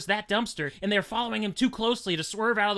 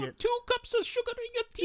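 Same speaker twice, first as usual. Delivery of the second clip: much higher, falsetto sugary offer; mock polite host; 3 dB quieter than usual. Co-host voicing the rival stepdad, high sugary mock politeness. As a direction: ask sweetly in a high put-on voice.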